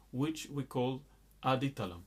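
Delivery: usual speaking voice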